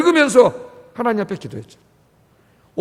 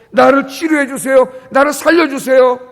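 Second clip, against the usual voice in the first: desperate plea; 4 semitones higher, 6 dB louder than usual. Shown as a demonstration. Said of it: "왕의 언어가 아니에요".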